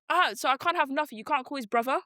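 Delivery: high-pitched exasperated protest; acted-out aggrieved outburst; mock outrage. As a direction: complain in mock disbelief, pitched well up. Retells own outraged reaction to casting.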